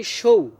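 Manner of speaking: সৌ said as shou, with sh; sh-sound demo. Shown as a demonstration. Teacher isolates the first syllable, clear sh. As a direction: give সৌ a clear palatal sh. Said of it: "মানে এসএইচ আসে".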